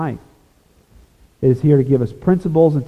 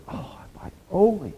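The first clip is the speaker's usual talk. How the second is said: higher, softer wail of regret; imitating regretful crying; 6 semitones higher, 9 dB quieter than usual. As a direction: mimic a lost soul's lament, hushed. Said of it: "People crying, going".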